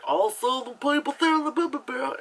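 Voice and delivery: high, loud mock-Southern gabble. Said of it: "You can't do a stereotype".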